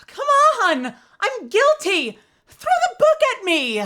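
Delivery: high, loud pleading yell; frantic shout. Acted. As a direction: beg to be convicted at the top of your voice. Ron yells he is guilty.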